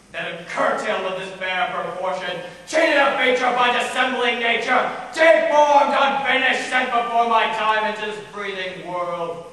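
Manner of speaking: full-force theatrical railing, easing slightly at the end; bitter outburst. Acted. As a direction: cry out bitterly against your deformity. Bitter soliloquy on own deformity.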